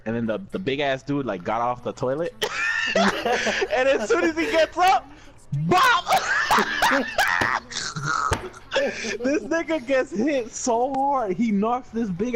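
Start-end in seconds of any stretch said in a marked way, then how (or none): 3.0-5.1 laughing through the words; can't hold back the laughter. Real, not acted. Laughing while telling the fight. 5.7-6.0 shouted punch sound; loud bop. Retold or imitated. 6.1-9.2 high howling laugh. Real, not acted. Big laugh after the punch.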